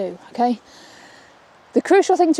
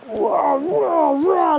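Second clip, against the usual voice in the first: mock yank on the lead; 4 semitones higher, much slower than usual. Shown as a demonstration. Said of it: "I'm not, like, going like this".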